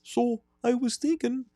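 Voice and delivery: high-pitched Wisconsin hockey accent; high sing-song Wisconsin creep; Ed Gein voice. Shown as a demonstration. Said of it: "my Ed Gein voice".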